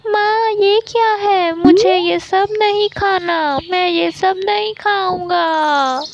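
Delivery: put-on high-pitched child refusing food; crying out in protest. A narrator's reading. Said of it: "वो खाना देखते ही चीख".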